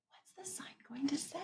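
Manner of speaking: quick hushed whisper of suspense. Shown as a demonstration. Teacher to class, building suspense in a whisper. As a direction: whisper it like a secret.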